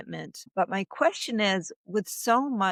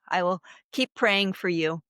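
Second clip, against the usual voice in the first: ordinary delivery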